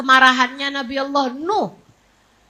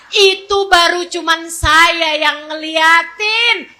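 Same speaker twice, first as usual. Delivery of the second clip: usual speaking voice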